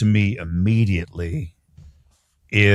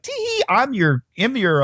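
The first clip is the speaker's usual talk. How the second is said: put-on ditzy quirky girl; 8 semitones higher than usual. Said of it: "she's like".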